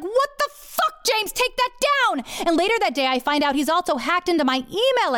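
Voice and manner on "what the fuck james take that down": very high, outraged yell; furious. Retold or imitated. Wife phoning her husband about his post, furious. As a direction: stretch the curse out high in outrage. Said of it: "I furiously dial my husband".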